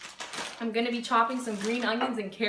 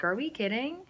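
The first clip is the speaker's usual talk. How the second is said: normal talking voice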